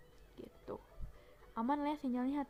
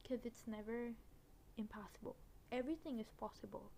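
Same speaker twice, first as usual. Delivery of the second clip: ordinary delivery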